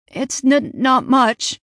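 nervous stammer, high and tense. A narrator's reading. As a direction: stumble over the words in fear. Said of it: "Ralph stammered".

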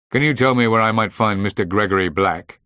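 loud, grandly official request; rather grand. A narrator's reading. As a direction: ask it with some pomp, projecting. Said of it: "he said rather grandly".

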